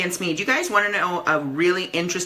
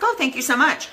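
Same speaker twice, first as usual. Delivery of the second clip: surprised thanks; 7 semitones higher than usual. Retold or imitated. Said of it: "And I was like".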